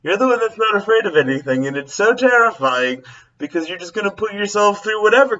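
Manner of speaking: mock her mom's alarmed warning; lightly voiced mother; imitating mom. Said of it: "she just goes".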